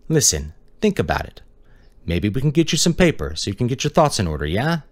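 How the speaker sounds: lightly voiced detective, coaxing and high. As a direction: try to sweet-talk a statement out of him.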